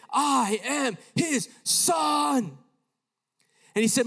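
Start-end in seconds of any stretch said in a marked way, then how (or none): 0.1-2.6 loud, high, hugely drawn-out proclamation; shouted voice. Retold or imitated. A voice answering the boy in Arabic, shouted. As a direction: boom it out slowly, stretching every word. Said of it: "But it shouted".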